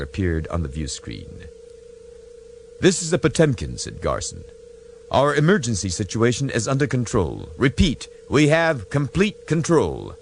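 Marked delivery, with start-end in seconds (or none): 2.8-10.2 firm starship captain. Acted. Garson reports to Romulans.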